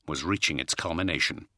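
ordinary delivery, nothing marked

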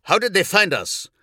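high shout over gunfire. Acted. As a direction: yell over the battle noise. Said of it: "had to shout to be heard".

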